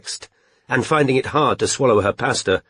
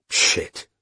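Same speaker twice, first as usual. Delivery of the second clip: stunned whisper; 3 dB quieter than usual. A narrator's reading. Strike stunned by the news.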